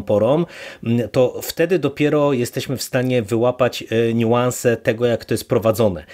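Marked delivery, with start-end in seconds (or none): none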